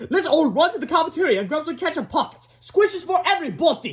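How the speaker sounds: high-pitched rallying call; shouting teacher. A narrator's reading. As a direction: call out to the whole class, voice way up. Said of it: "shouted Miss Ribble".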